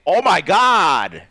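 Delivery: loud, drawn-out shocked cry. Real, not acted. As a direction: gasp it out in disbelief. Sees the phone-throw demo.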